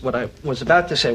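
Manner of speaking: ordinary delivery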